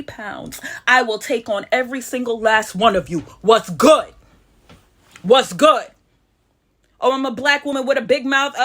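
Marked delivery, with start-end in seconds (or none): 3.4-4.1 defiant challenge. Real, not acted. 5.2-5.9 defiant challenge. Real, not acted.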